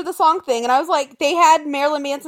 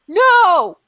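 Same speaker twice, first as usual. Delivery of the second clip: mock angry no; 3 semitones higher, 5 dB louder than usual. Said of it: "I was angry more than anything".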